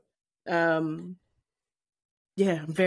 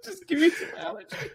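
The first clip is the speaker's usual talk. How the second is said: high laughing quote; 11 semitones higher than usual. Real, not acted. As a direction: say it through a laugh. Laughing repeat of the joke.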